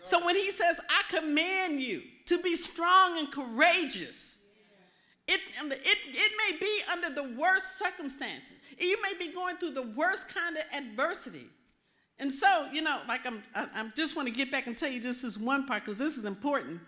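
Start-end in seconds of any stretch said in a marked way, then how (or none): 0.0-11.5 emphatic preaching. Real, not acted. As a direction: drive each phrase home like a preacher. Preaches to an audience at full force.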